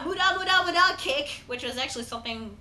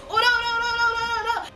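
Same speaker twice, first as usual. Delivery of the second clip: high sing-song panicked no's; panicked cry; 8 semitones higher, faster than usual. Retold or imitated. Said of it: "Jotaro and Star Platinum going".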